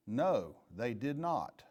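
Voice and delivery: slow it right down to make the point; slow emphatic no. Real, not acted. Chief answers his own question about promotions, slow and emphatic.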